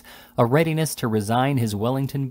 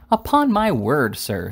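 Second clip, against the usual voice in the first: high, loud, slow indignant surprise; astonished; 5 semitones higher, 4 dB louder, slower than usual. A narrator's reading. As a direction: swoop up high in shock, then drop on the last word. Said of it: "said the astonished Missus Pott".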